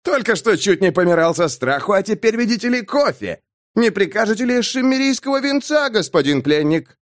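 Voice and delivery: high-pitched sarcastic ridicule; exaggerated, jeering incredulity; amused scoffing. A narrator's reading. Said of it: "хохотнул Бэкфилд".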